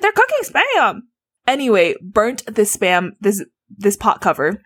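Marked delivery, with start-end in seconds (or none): 0.0-1.0 high, exasperated retort; hammed-up family squabble; mock quarrel shout. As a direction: argue back in a high imitated voice. Acted quarrel, sister and mom.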